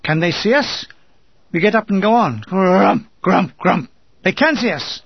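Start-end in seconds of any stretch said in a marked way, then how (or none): 2.4-3.9 vocalised shell bursts, rolled and thudding; short, booming explosion noises; mortar blast imitation. A narrator's reading. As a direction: imitate mortars landing one after another. Imitates mortar bombs landing.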